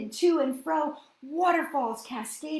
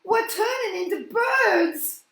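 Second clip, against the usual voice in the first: high, loud panicked shriek; panicked high shout; 9 semitones higher, 10 dB louder than usual. Acted. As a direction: shriek in horror. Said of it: "Al woke up and shrieked".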